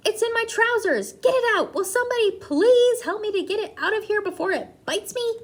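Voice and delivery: high, loud panicked shouting. A narrator's reading. Cook still shouting at the staff, loud and panicked. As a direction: howl for help in a panic. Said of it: "still shouting".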